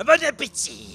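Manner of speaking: high, loud character greeting; NPC voice. Acted. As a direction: boom out a hearty welcome. NPC greets players at meal.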